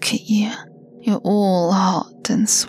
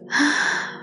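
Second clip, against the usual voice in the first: sharp gasp; 7 semitones higher than usual. Acted. Sudden sensual gasp.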